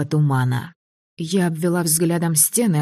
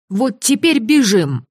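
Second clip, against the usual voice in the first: higher, louder, urgent call; urgent shout; 7 semitones higher, 4 dB louder than usual. Acted. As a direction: yell at him to run.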